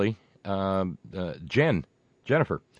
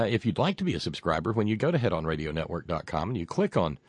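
ordinary talk

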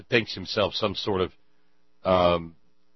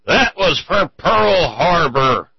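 slow, loud, higher grudge-bearing reply; grim retort; 8 semitones higher, 10 dB louder, slower than usual. Acted. Old man to bar neighbor, slow loud grudge. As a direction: spit out the reason slowly. Puncher explains in barroom joke.

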